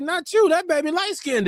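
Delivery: high, sly mock cousin voice; teasing cousin. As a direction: cut him off with a tease, way up high. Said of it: "She's like".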